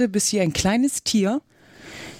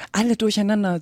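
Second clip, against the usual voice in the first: usual speaking voice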